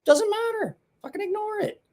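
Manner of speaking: high, mocking put-on voice; sing-song parody of brushing it off. Mocking commenters with a high put-on voice.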